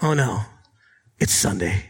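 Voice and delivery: low, sinking groan of dismay; a hammed-up sleepy oh no; mock dread. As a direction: sink into the dread of Sunday. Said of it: "You wake up and it's like".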